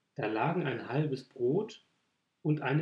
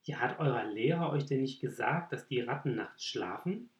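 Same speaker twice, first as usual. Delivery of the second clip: usual speaking voice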